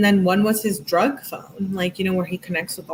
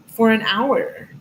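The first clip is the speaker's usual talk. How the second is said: "for an hour" stretched out, slightly higher; emphasized; 4 semitones higher, 4 dB louder, slower than usual. Retold or imitated. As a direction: lean indignantly hard on "for an hour". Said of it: "She emphasizes it".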